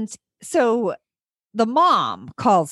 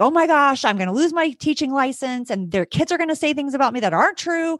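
higher-pitched, acted-out fear; retold panic; 6 semitones higher than usual. Teacher fearing for her teaching license, retold panic. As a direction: replay your freak-out with raised pitch. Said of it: "I went immediately to fear".

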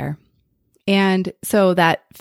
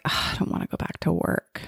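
breathy whisper, dreading work; 8 dB quieter than usual. Retold or imitated. To herself, dreading going back to work after break, a whispered groan. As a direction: whisper it like you're dragging your feet.

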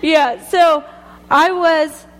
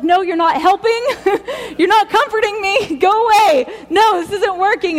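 frantic, higher, half laughing; mock freak-out; 6 semitones higher than usual. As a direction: wave the callers off in a laughing panic. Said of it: "I was freaking out".

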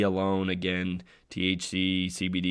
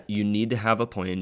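usual speaking voice

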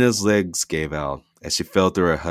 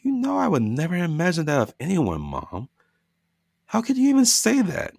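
hurt; 13 semitones higher than usual. Acted. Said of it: "hint of hurt".

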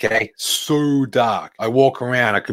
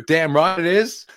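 slow, high, cocky agreement; defiant; 8 semitones higher, slower than usual. Real, not acted. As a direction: own it proudly, drawn out. Defiant reply to selfish charge.

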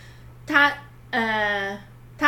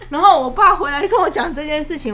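laughing; 7 semitones higher, 11 dB louder than usual. Real, not acted. Proud mother laughing at story.

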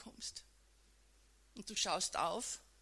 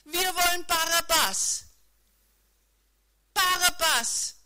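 loud chanting shout of an angry mob; crowd shouting; 7 semitones higher, 13 dB louder than usual. Acted. Preacher voicing the mob demanding Barabbas, loud shout. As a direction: yell it like a furious crowd. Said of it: "Die Menge beginnt auszurufen".

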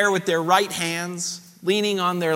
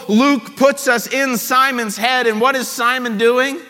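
usual speaking voice

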